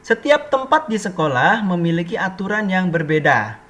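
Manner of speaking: loud, projected textbook reading; teacher reading aloud, loudly. Real, not acted. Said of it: "[name] bacakan bagian atasnya".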